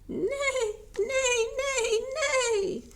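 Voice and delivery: high, loud whinnying neigh; whinny like a fed-up horse; complaining neigh. A narrator's reading. Chicks complaining in a horse's neigh. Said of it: "complained Little Chicks".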